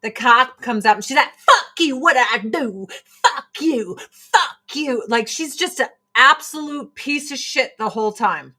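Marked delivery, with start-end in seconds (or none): 1.3-5.0 imitating a driver who swears at the cop; mock angry driver. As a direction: bark it like a rude driver.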